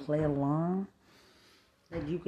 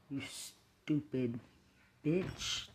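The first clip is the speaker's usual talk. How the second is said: slow, softer drawn-out insult; slow contempt; 2 semitones lower, 5 dB quieter, much slower than usual. Real, not acted. Insulting the woman slowly with cold contempt. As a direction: drag each word with contempt.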